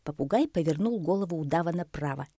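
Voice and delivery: ordinary delivery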